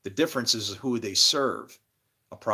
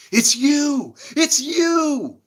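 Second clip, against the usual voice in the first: shrill high yell, much louder; screaming accusation; 10 dB louder, much slower than usual. Retold or imitated. Woman flying at him yelling it's you. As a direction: scream it in her face like you recognize him. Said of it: "she goes".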